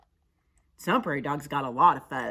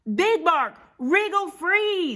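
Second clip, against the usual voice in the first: high, loud, stretched call; urgent shout; 13 semitones higher, 8 dB louder, slower than usual. Acted. Prairie dogs calling up to Big Bark, shouted. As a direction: shout up to someone far away. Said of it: "the prairie dog shouted".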